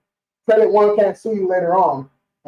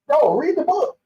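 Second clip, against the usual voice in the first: laughing it out, pitched up high; 9 semitones higher than usual. Real, not acted. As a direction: laugh through it in disbelief. Laughing at scammers who won't read.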